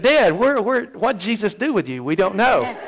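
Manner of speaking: put-on high, loud, incredulous kids' voices; mock bewildered kids. Kids asking their suddenly affectionate dad, high and loud. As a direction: play the baffled kids asking dad what happened.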